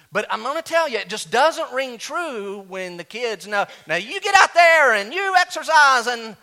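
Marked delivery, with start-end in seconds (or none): 3.9-6.4 much higher, loud barking gym teacher; hammed-up PE teacher shouting at students; mock coach yell. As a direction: bellow orders at the kids. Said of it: "Jarvis be a great P E teacher".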